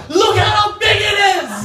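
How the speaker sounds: hammed-up bragging holler; boastful shout. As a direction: show off at full volume. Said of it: "waving it ... and going".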